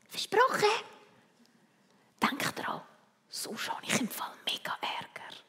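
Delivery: secretive whisper to a friend. Acted. Christoph whispers a secret to his friend Freddy. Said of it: "Und dann fängt er an zu flüstern".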